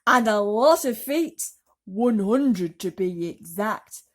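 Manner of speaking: loud proud bug brag; boastful centipede. Acted. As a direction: show off every single leg.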